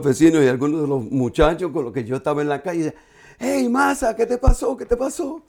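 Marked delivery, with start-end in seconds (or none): 3.4-5.4 higher-pitched imitated questioning; curious neighbours. Street acquaintances ask him what happened, imitated questioning. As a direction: voice the onlookers, pitched well up.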